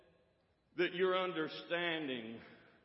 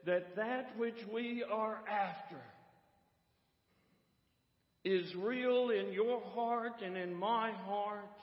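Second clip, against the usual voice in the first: raised, higher-pitched preaching with stretched stresses; impassioned preaching; 6 semitones higher, 4 dB louder than usual. Real, not acted. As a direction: exhort the congregation in measured, ringing phrases. Preaching appeal after story.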